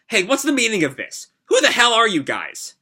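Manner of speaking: high, startled protest; a captive girl demanding answers; indignant girl. Acted. Captive girls protest, voiced.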